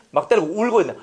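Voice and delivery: usual speaking voice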